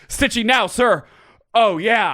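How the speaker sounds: high barked reply; stiff barking toy soldier. Pretend. Soldier roleplay.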